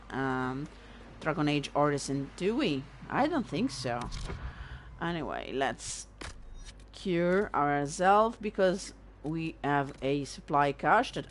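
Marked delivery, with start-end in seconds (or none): none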